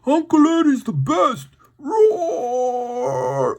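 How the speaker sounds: high, loud roaring dinosaur. Pretend. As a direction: play a goofy dinosaur bellowing. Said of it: "I can guess they sounded like this".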